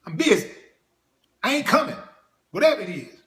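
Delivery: annoyed shout. Pretend. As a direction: snap at him like you're busy and sick of being called. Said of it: "If my name was Father, I'd be".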